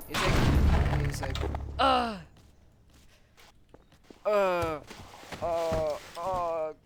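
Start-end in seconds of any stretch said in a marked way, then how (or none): 1.8-6.7 loud groans falling in pitch; mock dying groans. Figure almost dead from shots, mock dying groans. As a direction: pretend groans, gradually weakening.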